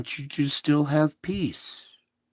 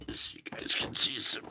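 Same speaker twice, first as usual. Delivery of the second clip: soft whispered aside; 2 semitones lower, 11 dB quieter than usual. Real, not acted. Host quietly shows viewers the images, whispering. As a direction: breathe it out under your voice.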